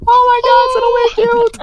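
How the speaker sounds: very high, loud gushing squeal; cutesy squeal. Retold or imitated. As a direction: squeal over something adorable. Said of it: "je suis comme".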